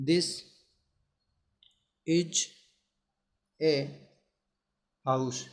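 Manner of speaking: each word set apart by long pauses. Shown as a demonstration. Teacher dictating the example, slow and spaced. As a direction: dictate it slowly, one word at a time. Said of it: "क्या लिखा है".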